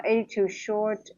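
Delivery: ordinary delivery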